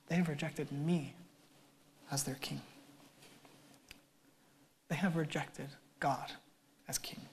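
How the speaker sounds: hushed solemn sermon voice. Acted. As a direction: let it land quietly.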